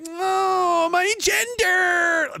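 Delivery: high, drawn-out mock wail; hammed-up cry of distress. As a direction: whine it high and slow, mocking a complainer. Mocking present-day people's petty complaints.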